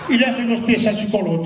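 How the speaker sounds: normal talking voice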